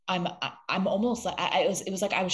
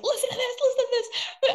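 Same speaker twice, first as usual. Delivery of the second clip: very high, loud squeal; giddy high-pitched mock pestering; retold eager urging; 7 dB louder than usual. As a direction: insist excitedly, voice shooting right up. Retells eagerly playing messages to coach.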